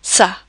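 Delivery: sa with an S sound, not K; soft C. Shown as a demonstration. Teacher explaining the cedilla, sa with soft S sound. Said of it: "that would be a soft C sound".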